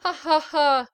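put-on big, loud drunken laugh. Tipsy marquis laughing at the girl, loud laugh. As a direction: laugh out loud, merry with wine. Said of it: "se mit à rire".

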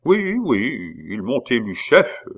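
high-pitched, self-satisfied confirmation; complacent. A narrator's reading. Pitou tells the abbé of his election, complacently. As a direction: savour the honour as you repeat it. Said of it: "répéta Pitou complaisamment".

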